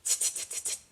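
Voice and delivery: soft unvoiced teasing tsk tsk; cheeky monkeys clicking back at him. A narrator's reading. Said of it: "the monkeys only looked at him and said".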